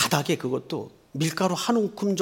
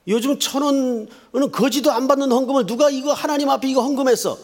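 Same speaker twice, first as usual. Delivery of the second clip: imitated pastor scolding, pitched high and raised; mock bullying rebuke; 9 semitones higher, 4 dB louder than usual. Imitating a pastor shaming people over small offerings, bullying shout. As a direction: browbeat the congregation in a mock tirade. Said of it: "막 협박하는 분들이 가끔 계세요".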